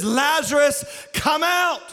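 high, commanding summons; calling out. Acted. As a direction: summon a man out of his tomb. Jesus calling the dead man out.

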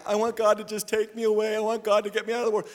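lightly mimicked complainer, a little hurried; mock pleading. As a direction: imitate someone begging for escape in one even tone. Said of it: "Some of you are like".

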